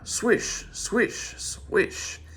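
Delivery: high unvoiced swish noises; broom swishes. A narrator's reading. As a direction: sweep the words out like a broom. Broom sweeping him away.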